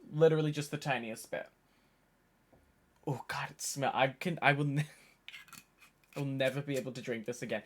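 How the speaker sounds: ordinary talk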